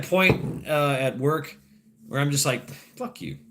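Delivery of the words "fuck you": whispered curse. Retold or imitated. Inner reply at work.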